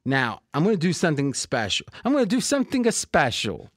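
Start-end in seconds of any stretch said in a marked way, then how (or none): 2.0-3.7 put-on high, sing-song Italian accent. Host repeats his promise of a special, Italian accent. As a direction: lift it into a playful Italian accent. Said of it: "went into an Italian accent".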